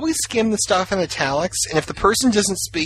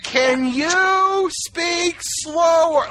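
talking to a foreigner; 12 semitones higher, much slower than usual. Retold or imitated. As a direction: shout it slowly like to a foreigner. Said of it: "I always scream at Spanish-speaking people in English".

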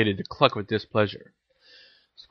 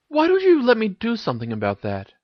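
put-on higher-pitched girl character's offer; lightly voiced Ginny; 12 semitones higher than usual. A narrator's reading. Ginny offers Harry help with his glasses, lightly voiced, higher.

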